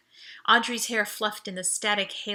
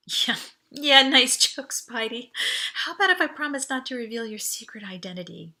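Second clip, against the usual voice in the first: playful, unbelieving tease; teasing; 3 semitones higher, 5 dB louder than usual. Acted. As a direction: brush it off laughing. Audrey teasing, thinks joke.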